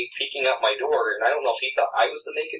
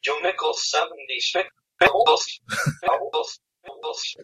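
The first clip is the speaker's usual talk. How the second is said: mangling announcer; 6 semitones higher, slower than usual. Retold or imitated. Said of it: "He said".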